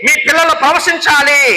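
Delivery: high-pitched preacher's cry; impassioned proclamation. Real, not acted. Preacher declaring promise over congregation's children, high fervent call.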